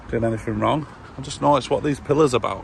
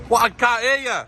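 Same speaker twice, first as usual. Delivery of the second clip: raised voice, calling across distance; shouting over machinery; 11 semitones higher than usual. Real, not acted. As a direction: shout so he can hear you. Shouted exchange across camp fence.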